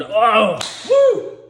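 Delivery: loud swooping high "oh, woo"; imitated flashback whoosh. Imitates the flashback hand reaching down.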